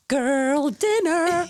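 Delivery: put-on slow, high, drawn-out girl dinner; mock-glam drawl; drawled trend name. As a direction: say it like a TikTok catchphrase. Names TikTok trend.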